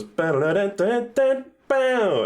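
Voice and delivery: rising mock fanfare, drawn-out final note; high sing-song "ba-da-da" flourish; imitated game jingle. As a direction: vocalise a little victory jingle. Imitating game's moon fanfare.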